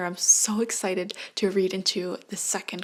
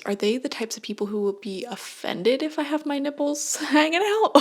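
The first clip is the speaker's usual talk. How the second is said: giggling, higher and louder retold question; laughing question; 3 semitones higher, 4 dB louder than usual. Asking her friend about bras, laughing and louder. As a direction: ask through giggles, half embarrassed. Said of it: "I'm like".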